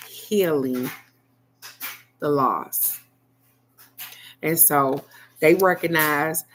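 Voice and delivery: normal talking voice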